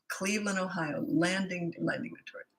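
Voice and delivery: murmuring to self. Real, not acted. Streamer puzzling over viewers' hometowns, murmuring to herself. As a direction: think aloud under your breath, puzzling over place names.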